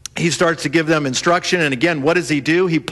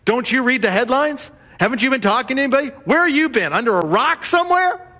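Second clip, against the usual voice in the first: higher and rising, fast incredulous scolding; mock chewing out; 6 semitones higher than usual. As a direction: rib him for being out of the loop. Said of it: "they chew Jesus out".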